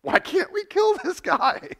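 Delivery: high, slow, whiny exasperated voice; mock their frustration; frustrated enemies. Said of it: "they're frustrated".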